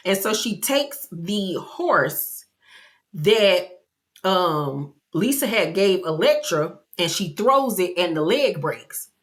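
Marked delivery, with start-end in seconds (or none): none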